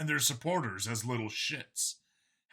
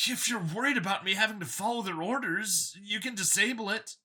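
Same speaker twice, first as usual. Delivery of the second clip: a cheerful zealot pitched up; android copy, eager; 8 semitones higher than usual. A narrator's reading. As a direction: sell it like a proud believer. Copy offers freedom.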